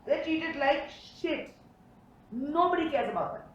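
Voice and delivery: usual speaking voice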